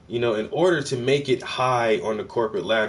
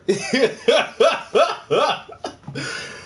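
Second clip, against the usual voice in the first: high, loud cackling laugh; 13 semitones higher, 3 dB louder than usual. Real, not acted. Streamer laughing after joking about forced apologies, high cackle. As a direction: burst into a long high cackle.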